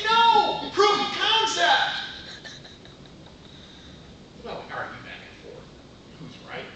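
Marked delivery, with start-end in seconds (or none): 0.0-1.9 emphatic correction. Retold or imitated. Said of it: "I say".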